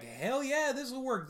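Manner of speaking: high, loud, cocky cheer; gung-ho mock enthusiasm. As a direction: cheer on a bad idea with full confidence. Voicing gung-ho people back then.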